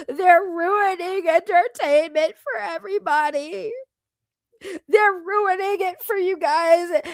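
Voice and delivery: hammed-up tearful whine, words dragged out; mock crying. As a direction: plead like a wailing crybaby, badly faked. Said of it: "That's what they really think that I sound like".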